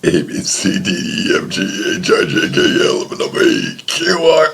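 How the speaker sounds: burping through the alphabet; burping the words. Pretend. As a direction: ride the burp through each letter. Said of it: "Gonna be burping the A B Cs".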